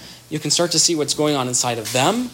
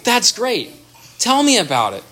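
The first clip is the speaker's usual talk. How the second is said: enthusiastic reply; 7 semitones higher than usual. Retold or imitated. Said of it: "And the wife goes".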